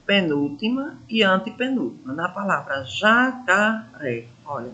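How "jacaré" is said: "jacaré" devagar, sílaba por sílaba; sílaba mais forte. Shown as a demonstration. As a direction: split "jacaré" slowly into ja, ca, ré to show the stressed syllable. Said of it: "Olha mais forte". ("jacaré" silabado, bem devagar, mostrando a sílaba tônica)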